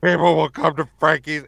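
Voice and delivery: loud strangled plea; squeezed, desperate. Shown as a demonstration. Actor squeezing his neck mid-line, desperate choked voice. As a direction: push the words through a squeezed neck. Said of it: "I would just start squeezing my neck".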